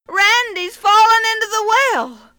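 loud urgent shout; calling out. Acted. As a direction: call out in alarm. Father calls for help.

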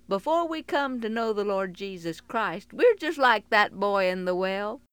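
ordinary delivery

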